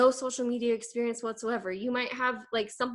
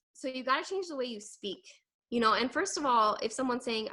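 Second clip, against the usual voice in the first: ordinary delivery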